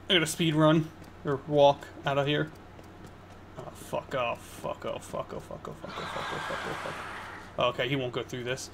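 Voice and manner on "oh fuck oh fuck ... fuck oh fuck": hushed, frantic string of curses; panicked whispered cursing. Real, not acted. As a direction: whisper oh fuck over and over in panic. Panicked muttering while fleeing.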